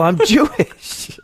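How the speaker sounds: high, loud, drawn-out cry; mock yell. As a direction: yell it long and high as a joke. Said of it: "Did he yell".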